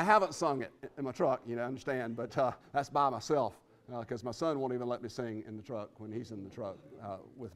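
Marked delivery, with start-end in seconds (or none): none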